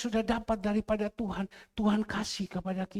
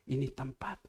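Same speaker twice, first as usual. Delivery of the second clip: whispered aside; 6 semitones lower, 7 dB quieter than usual. Retold or imitated. Showing video to friend.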